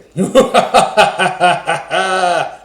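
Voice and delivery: loud booming villain cackle; evil pirate laugh. Shown as a demonstration. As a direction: belt out a hearty pirate laugh. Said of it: "I'll demonstrate".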